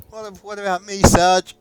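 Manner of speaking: high, loud whiny grunt; nervous little soldier voice; squeaky soldier. Retold or imitated. Voicing soldier to sergeant.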